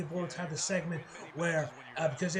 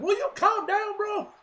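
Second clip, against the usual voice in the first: very high, loud imitation of a fed-up man; hammed-up exasperated plea, pitched way up; mock exasperation; 14 semitones higher, 6 dB louder, slower than usual. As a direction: squeal it in pretend outrage. Imitates Tony fighting back.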